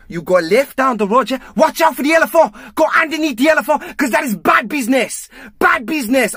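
high, exclaiming mock Nigerian sat nav; Nigerian accent. Sat nav warning a driver of danger, hammed-up Nigerian accent. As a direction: exaggerate a Nigerian accent, pitched far up. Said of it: "Then Nigeria came up".

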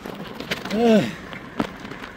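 breathless exhale. Real, not acted. Exhausted at the hilltop.

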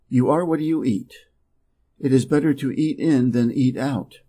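ordinary delivery

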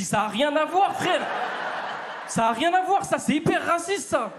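loud high indignant shout; arguing with his friend, loud and high; heated shout. Retold or imitated. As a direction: yell back at your friend in outrage. Retold heated argument with friend.

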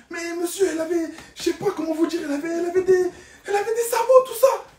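climbing into a panicked squeal at sabots. Retold or imitated. Panicked passenger telling the driver what he saw, frantic and high.

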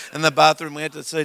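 ordinary delivery

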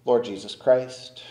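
ordinary talk

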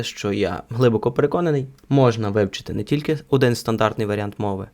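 normal talking voice

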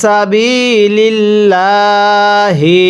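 সাবিলিল্লাহি drawn out slowly with a rising end; slow recitation. Shown as a demonstration. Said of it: "কিভাবে পড়ব". (ধীরে টেনে সাবিলিল্লাহি)

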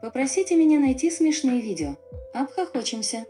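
usual speaking voice

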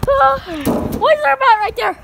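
ordinary delivery

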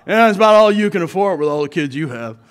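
imitated neighbor's loud jab; Louie. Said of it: "he talked like this".

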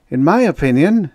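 high, loud, weighty opinion; judicious. A narrator's reading. Officer giving his view on the outpost, judicious. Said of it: "he said judiciously".

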